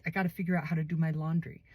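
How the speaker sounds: ordinary delivery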